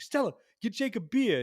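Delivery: loud call to the kitchen; hammed-up Joe hollering for beer; imitating a loud uncle. Uncle yelling across the room.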